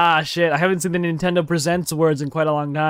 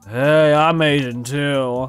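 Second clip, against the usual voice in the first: low, very slow character introduction; corrected character voice; 3 semitones lower, 4 dB louder, much slower than usual. Acted. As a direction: drop lower and stretch the greeting out. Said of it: "Oh, sorry, no, sorry".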